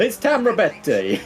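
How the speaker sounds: put-on high, slow Italian accent. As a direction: announce it in a hammy Italian accent. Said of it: "I don't know why I put an Italian accent on it".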